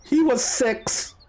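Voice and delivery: high, slow mother's reply; lightly acted distraught mother; grieving mother. Mother answers about her dead son.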